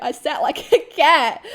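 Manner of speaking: laughing. Real, not acted. Laughing at own story.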